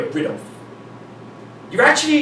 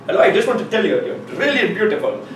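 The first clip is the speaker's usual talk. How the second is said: imitated Indian accent, slightly raised pitch; bad Indian accent; 4 semitones higher than usual. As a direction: mimic a hammy Indian accent. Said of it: "bad Indian accent".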